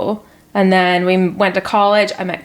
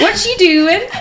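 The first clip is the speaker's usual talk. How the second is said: high mock Irish accent; child's Irish accent; 12 semitones higher, 4 dB louder, slower than usual. Mum repeats her daughter's phrase for host, high pretend Irish accent. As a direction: imitate a small child's put-on Irish, pitched up. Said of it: "like, a little Irish accent".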